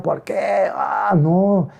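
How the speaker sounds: drawn-out cartoonish wail, lightly done; mock crying. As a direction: whine it long like a child's fake cry. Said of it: "como la chilindrina llorando".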